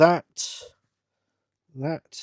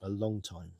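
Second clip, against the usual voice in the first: softer, lower aside; wry aside; 4 semitones lower, 8 dB quieter than usual. Real, not acted. Married man on being used to it, wry aside. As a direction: drop the voice for a rueful aside.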